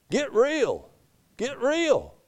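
high, swooping mock scoff; mocking dismissal. Preacher voicing what the misread verse implies, mocking dismissal. Mocking the altered reading's meaning.

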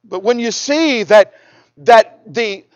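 usual speaking voice

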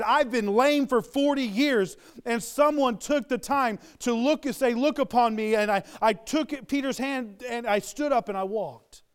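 usual speaking voice

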